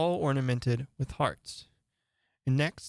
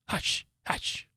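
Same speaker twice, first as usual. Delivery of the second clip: whispered, urgent hush; low hurried hush. Acted. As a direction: hush her quickly and secretly. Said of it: "in a low, hurried tone".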